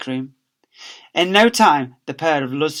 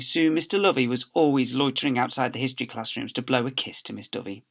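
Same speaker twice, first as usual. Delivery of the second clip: plain reading voice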